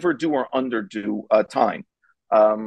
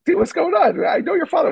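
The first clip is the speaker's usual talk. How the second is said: confused; 4 dB louder than usual. Retold or imitated. Retelling confused plea to friend.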